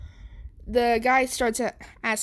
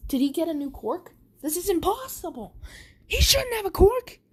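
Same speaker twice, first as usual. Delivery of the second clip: rising disbelief; 10 semitones higher than usual. A narrator's reading. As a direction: build to an incredulous cry.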